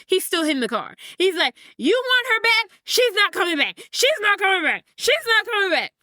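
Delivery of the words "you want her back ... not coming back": high, heated, repeated cries; acted anger. Son shouts at his father while hitting the car, acted anger. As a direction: hammer each phrase in mounting distress. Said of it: "his anger quickly transitions into tears".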